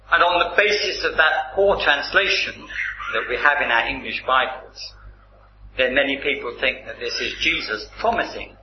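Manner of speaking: normal talking voice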